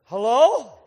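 loud, rising call; incredulous, questioning shout; pointed hello. Real, not acted. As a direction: call it out as if it's obvious. Calling out after the punchline.

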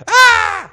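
high, loud mock scream of fright. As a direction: shriek like seeing a ghost. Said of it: "They screamed".

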